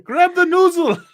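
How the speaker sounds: loud, very high, drawn-out Scottish; Groundskeeper Willie. Acted. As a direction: yell it slowly in a cartoon Scottish accent. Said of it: "groundskeeper Willie impersonations".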